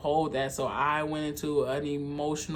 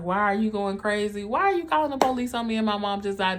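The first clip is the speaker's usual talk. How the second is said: higher, louder, fast accusing partner; angry partner; 5 semitones higher, 4 dB louder, faster than usual. Retold or imitated. Partner yelling at her after the funeral, higher and fast. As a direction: throw his angry questions back at her fast. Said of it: "he was like".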